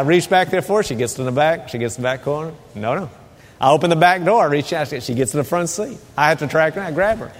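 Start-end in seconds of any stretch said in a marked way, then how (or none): none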